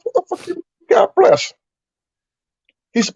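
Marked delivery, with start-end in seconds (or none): 0.0-1.5 very high, incredulous squeak; retold bafflement. Retold baffled reply to cellmate.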